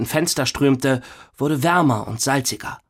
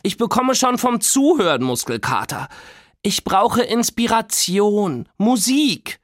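put-on demanding girl; 9 semitones higher than usual. A narrator's reading. Nora demands music.

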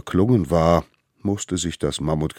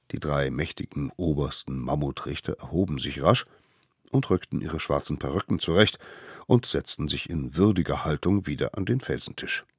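ordinary delivery, nothing marked

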